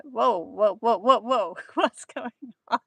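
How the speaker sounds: startled whoa. Retold or imitated. Startled by friend's scream.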